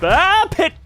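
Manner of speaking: high, loud sudden cry of alarm; startled yelp. Real, not acted. Streamer suddenly spotting a pit, yelping high and loud. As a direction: yelp as the pit appears under you.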